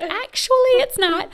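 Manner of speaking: a teasing, mock contradiction pitched high; mock correction. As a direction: pop up high to correct her jokingly. Joking inner retort about hair.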